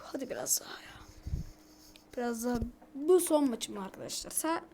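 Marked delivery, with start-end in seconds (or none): 0.0-1.0 breathy whisper to himself, much lower pitch. Real, not acted. Gamer pleading with himself for one more match, whispered. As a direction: coax yourself on in a hushed whisper.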